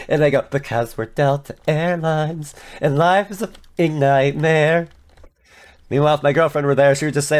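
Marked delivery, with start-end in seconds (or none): 0.4-4.9 slow, grim mock pronouncement; slow deadpan declaration. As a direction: announce it like doom. Airline's absurd answer.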